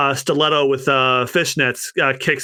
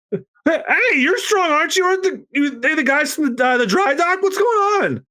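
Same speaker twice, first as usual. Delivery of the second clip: higher startled cry for Mozu; sister cries out; 13 semitones higher than usual. Acted. Kiwi sees Mozu sent flying, startled.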